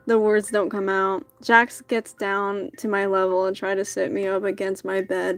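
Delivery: usual speaking voice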